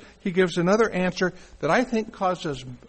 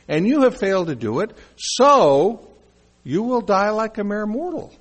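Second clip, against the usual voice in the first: raised, emphatic pronouncement with a loud "so"; God's stern rebuke; 4 semitones higher, 5 dB louder than usual. Acted. God addresses the failed judges of Israel, stern rebuke. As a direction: pass judgement firmly, leaning hard on the turn. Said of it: "is the way God is addressing this".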